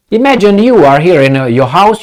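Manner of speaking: normal talking voice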